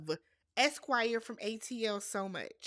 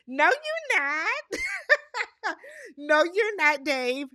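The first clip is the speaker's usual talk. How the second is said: high, loud protest cracking into laughter; laughing disbelief; 8 semitones higher, 7 dB louder, slower than usual. Real, not acted. Recapper rejecting Dave's exclusivity claim, laughing in disbelief. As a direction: laugh while flatly refusing to buy it.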